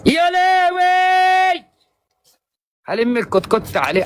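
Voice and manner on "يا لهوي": drawn-out wail. Real, not acted. Streamer cries out at bad luck.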